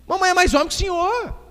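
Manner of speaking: put-on high-pitched little boy talking back; menininho. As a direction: pipe up like a child challenging dad. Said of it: "O menininho olhou". (voz aguda de menino respondendo ao pai)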